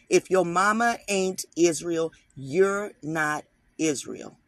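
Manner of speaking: loud, slow, preacher-style insistence on mama; emphatic declaration. Real, not acted. Laying down the rule to viewers, loud and emphatic. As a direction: hammer the point home to the viewers. Preaching who counts as Israel.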